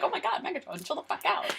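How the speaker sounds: soft, voiceless exclamation; hushed mock dismay. Fan reacting to Megatron's overkill, hushed mock dismay. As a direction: drop into an aghast near-whisper. Acted aghast reaction to the killing.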